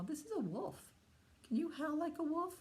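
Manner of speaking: ordinary talk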